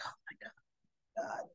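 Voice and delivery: breathless whispered oh my God; retold panicked whisper. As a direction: hush it out, barely voiced. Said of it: "Dex is feeling like".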